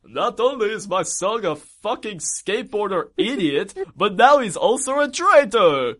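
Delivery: theatrical mock dismay, pitch rising; Dracula. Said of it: "Dracula's there and he goes".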